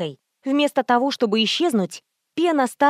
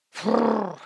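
snorting snort; 3 dB quieter than usual. A narrator's reading. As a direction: snort like a beast. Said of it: "я услышала".

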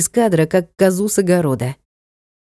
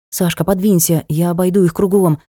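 ordinary delivery

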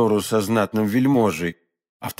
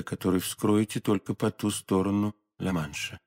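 softer, restrained conspiratorial exchange; hushed dialogue; 4 semitones lower, 6 dB quieter than usual. A narrator's reading. Lady and stranger discussing secret orders, hushed. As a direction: keep it quiet, between two plotters.

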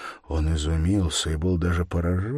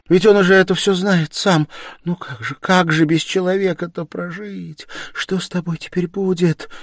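high, pleading woman's voice; distraught lament; 12 semitones higher, 7 dB louder than usual. A narrator's reading. Sonya fearing for his future, distraught. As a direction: wail the questions, pitched high.

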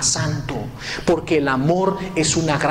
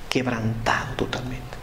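breathy whisper, voice gone; 6 dB quieter than usual. Acted. Preacher telling of the weeping husband, whispered. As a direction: whisper it as if broken inside.

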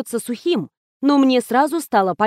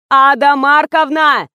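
loud, slow, higher call of her name; 4 semitones higher, 7 dB louder, slower than usual. A narrator's reading. Administrator calls the distracted old actress, loud. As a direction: shout to get her attention.

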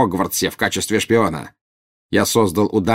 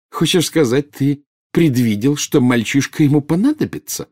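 high, derisive question; mocking exclamation; 7 semitones higher, 3 dB louder than usual. A narrator's reading. Bellatrix ridiculing Snape's supposed foresight, mocking. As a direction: ridicule their claim in disbelief. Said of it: "воскликнула она с насмешкой".

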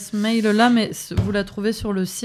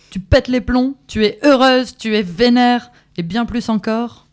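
louder and higher, rising to a rallying shout; building to a climax; 5 semitones higher, 8 dB louder than usual. Acted. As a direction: push the final lines up to full power. Reading climaxes.

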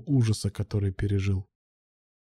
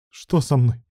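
ordinary delivery, nothing marked

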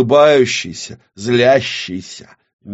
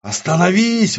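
high-pitched desperate cry; 10 semitones higher than usual. Acted. Witch begs the reflection to stop, desperately. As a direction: plead at a shout, despairing. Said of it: "в голосе женщины слышалось отчаяние".

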